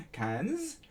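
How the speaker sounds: "cans" with the pitch rising high at the end. Shown as a demonstration. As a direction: lift "cans" upward like a question. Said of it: "You could also do cans".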